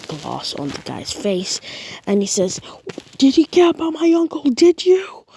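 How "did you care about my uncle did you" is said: high, loud demanding yell; furious shout. Acted. Deku confronting his uncle's killer, furious shout. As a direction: scream it into his face.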